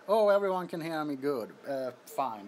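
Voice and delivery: ordinary talk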